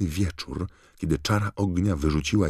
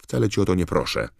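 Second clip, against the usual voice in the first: quick, higher-pitched retort; impatient; 4 semitones higher, 4 dB louder, faster than usual. A narrator's reading. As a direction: brush the objection aside impatiently and fast. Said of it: "odpowiedziała niecierpliwie Hermiona". (szybko, podniesionym tonem, niecierpliwie)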